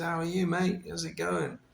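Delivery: lightly imitated Cockney twang; Gary's Cockney twang. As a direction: check in like an old Cockney pal. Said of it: "with his sort of Cockney twang".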